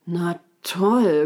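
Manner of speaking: mildly grumpy, drawn-out words; understated fed-up grumble; grumbling. A narrator's reading. Unicorn grumbles about meeting this raccoon, mildly fed up. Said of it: "grummelte das Neinhorn".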